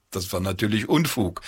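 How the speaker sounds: plain reading voice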